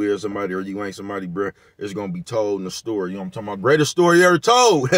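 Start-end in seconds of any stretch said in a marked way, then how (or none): 3.6-4.9 big booming trailer announcer, higher and louder; hammed-up movie promo. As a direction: announce it like an epic film trailer.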